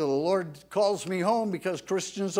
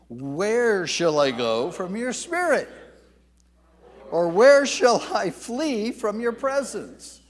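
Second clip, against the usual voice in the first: slow, swelling scripture reading, louder at the close; read with feeling; 4 semitones higher, 6 dB louder than usual. Shown as a demonstration. Preacher leading the congregation in reading, slow and stirring. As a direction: let each question ring out slowly. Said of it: "Let's read this aloud with feeling".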